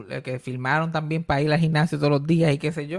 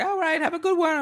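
high, bright mock American customer; gringa customer; 12 semitones higher than usual. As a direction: chirp it like a friendly cashier. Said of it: "me han dicho como que".

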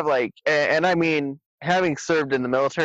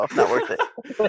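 laughing; 8 semitones higher, slower than usual. Real, not acted. Joking about angering community.

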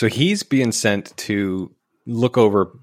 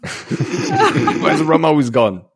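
slow, higher laughing pirate drawl; Jack Sparrow impression; 6 semitones higher, 3 dB louder, slower than usual. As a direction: laugh through a tipsy pirate line. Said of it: "corrects the quote".

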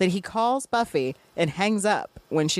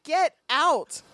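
annoyed; 6 semitones higher, slower than usual. Retold or imitated. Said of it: "And she's like".

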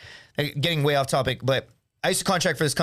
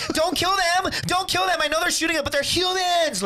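very high, whiny pleading; mock wimpy pacifist. Pacifist soldier pleading for the enemy, mock high-pitched wail. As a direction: caricature a squeamish soldier begging. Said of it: "Some fucking pussy that's like".

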